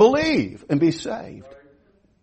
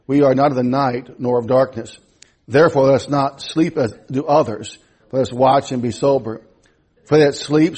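lower, measured reading of King James verses; scripture reading; 7 semitones lower than usual. Acted. Preacher reads First Thessalonians to his congregation, in a calm, measured reading voice. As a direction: read the passage steadily to the church.

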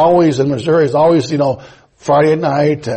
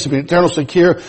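usual speaking voice

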